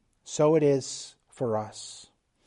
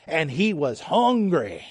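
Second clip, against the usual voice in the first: put-on slow, drawn-out northern Louisiana accent, swooping on "hungry"; 3 semitones higher, 3 dB louder, slower than usual. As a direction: imitate a northern Louisiana preacher stretching out "hungry". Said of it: "in his northern Louisiana accent".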